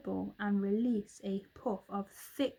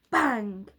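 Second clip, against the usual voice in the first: gunshot bang; 8 dB louder than usual. A narrator's reading. Gunshot sound effect.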